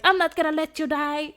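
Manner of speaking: high, loud hammed-up promise; mock heroic vow. Defender promising the girl she won't die, hammed-up loud and high. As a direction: overplay the protector's vow. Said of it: "And he's like".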